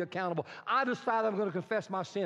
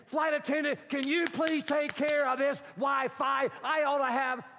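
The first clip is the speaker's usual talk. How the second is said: raised, indignant demand; irate passenger; 5 semitones higher than usual. Acted. Passenger to flight attendant about slow Wi-Fi, indignant.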